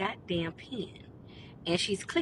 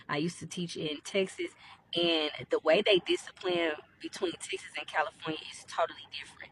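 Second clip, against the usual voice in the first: ordinary delivery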